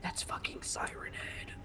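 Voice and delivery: hushed frightened whisper. Real, not acted. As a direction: whisper it so the monster won't hear. Spots monster, whispers.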